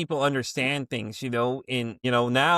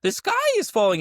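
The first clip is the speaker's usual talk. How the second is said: high-pitched mock-panicked cry; hammed-up doomsday alarm, pitched way up; Chicken Little panic; 4 dB louder than usual. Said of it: "I'm having a Chicken Little moment".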